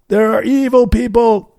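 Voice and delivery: high, loud stage announcer voice; rally the cheering conference crowd with a big opener; booming keynote. Retold or imitated. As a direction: belt it out like a hyped keynote speaker. Keynote line continued.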